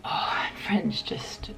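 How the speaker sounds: usual speaking voice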